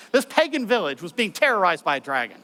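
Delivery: ordinary delivery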